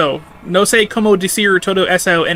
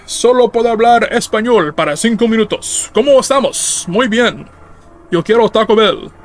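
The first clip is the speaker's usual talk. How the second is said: hammed-up Spanish; 5 semitones higher than usual. Starts forced Spanish-only mode.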